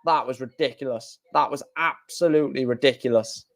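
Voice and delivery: low, soft murmur of amazement; quiet disbelief. Real, not acted. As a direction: shake your head as you say it. Quiet disbelief after the match.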